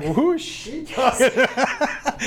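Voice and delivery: high, slow and puzzled; a bewildered, drawn-out question; mock confusion to nobody in particular; puzzled aside. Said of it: "And I was like".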